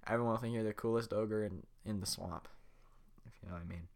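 ordinary talk, nothing marked

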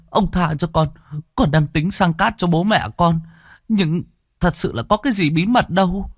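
high pleading voice; begging peasant. Acted. Beaten peasant begging the chief, high pleading.

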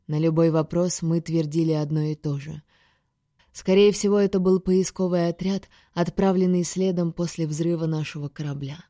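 usual speaking voice

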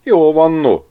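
high, loud, taken-aback protest; startled. A narrator's reading. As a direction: back off in alarm. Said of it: "ijedt meg Ágnes". (megijedve, magas hangon)

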